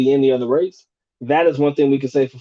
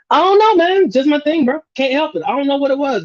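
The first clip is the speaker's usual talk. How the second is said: pitched up and sheepish; sheepish excuse; 9 semitones higher than usual. Real, not acted. Streamer admitting an old habit, sheepish and higher. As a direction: shrug it off, embarrassed.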